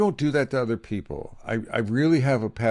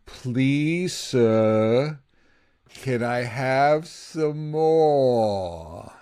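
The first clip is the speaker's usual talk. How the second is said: put-on drawn-out, higher English beggar-boy plea; pleading movie orphan; 3 semitones higher, much slower than usual. Podcast host quoting an old English movie, drawn-out plea. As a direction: beg slowly, stretching out the last word. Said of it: "it's from an old movie".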